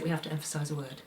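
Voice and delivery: soft, quick throwaway delivery; under-energized. Shown as a demonstration. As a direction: let it drop away with little energy. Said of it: "if I under-energize it".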